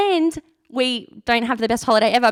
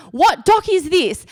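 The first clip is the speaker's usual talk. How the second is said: higher-pitched, slow question to a stranger; acted urgent question; 7 semitones higher, slower than usual. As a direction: stretch it out, pitched up, as if stopping a passer-by. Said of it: "run up to someone, ask".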